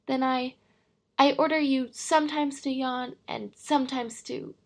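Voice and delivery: flustered king, loud and stumbling; sputtering. Acted. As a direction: splutter the order, losing the thread. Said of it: "he sputtered a little".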